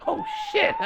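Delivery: high-pitched startled yelp; mock Trump surprise. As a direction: act the startled new president. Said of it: "even Trump was like".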